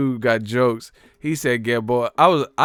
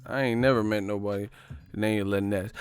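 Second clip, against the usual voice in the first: normal talking voice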